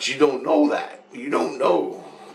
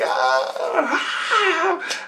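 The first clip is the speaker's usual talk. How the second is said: mock pained cries. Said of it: "He's like".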